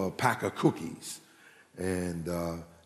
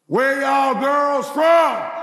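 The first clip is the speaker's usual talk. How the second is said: much higher, louder, slow hollered pickup line; yelled country drawl; 8 dB louder, much slower than usual. Retold or imitated. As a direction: holler it like a country boy who won't quit. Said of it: "He said".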